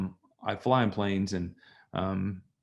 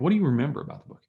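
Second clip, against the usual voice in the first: faster, keen asking; eager quick question; 6 semitones higher, much faster than usual. Retold or imitated. Speaker pressing the businessman on the plane, eager. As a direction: rush the question out. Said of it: "I'm like".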